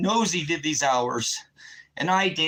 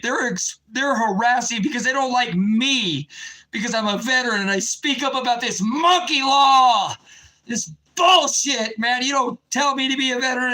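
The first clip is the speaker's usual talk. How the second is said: louder, higher, furious shouting; fired-up rant; 6 semitones higher, 4 dB louder than usual. Real, not acted. Veteran ranting to his livestream viewers, furious shout. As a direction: blast the injustice at the audience. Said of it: "I'm just so fired up".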